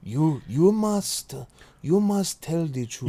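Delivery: overdone, poorly attempted African doctor accent. Co-host mocking the film's terrible accent, hammed-up African accent. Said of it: "as strong as [name] was attempting to do poorly".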